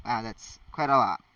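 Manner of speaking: plain reading voice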